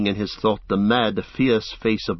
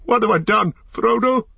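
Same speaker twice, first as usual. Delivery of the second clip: very high, raised-voice cry of remorse; desperate calling; 12 semitones higher, 3 dB louder than usual. A narrator's reading. Boromir calls after vanished Frodo, high desperate cry. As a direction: call out urgently after someone gone. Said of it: "he called".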